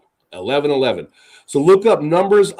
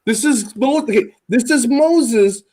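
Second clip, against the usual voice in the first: ordinary talk, nothing marked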